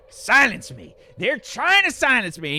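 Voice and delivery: high, loud, drawn-out protest; indignant ranting crank. Acted. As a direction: cry out like a persecuted street preacher. Plays ranting street crank.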